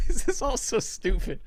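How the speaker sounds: talking through real giggles; can barely get the words out for laughing; helpless laughter. Real, not acted. Host laughing at how dumb the show is, giggly. Cracking up over own silliness.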